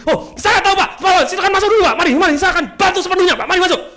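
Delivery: high, loud, urgent offer of help; match the angry customer's shouting with helpful words; equally fierce. Retold or imitated. Trained employee matching an angry customer's force, fierce and loud. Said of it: "sama galaknya".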